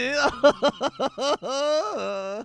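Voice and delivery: high sly gloating chuckle; gloating laugh. Acted. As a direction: laugh like a plotting schemer. Scheming detective gloats.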